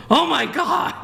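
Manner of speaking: high, panicky Oh my God; shocked gasp. Retold or imitated. Panic as man dangles electrocuted.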